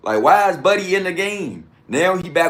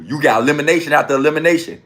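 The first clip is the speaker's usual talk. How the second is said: usual speaking voice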